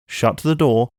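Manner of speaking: plain reading voice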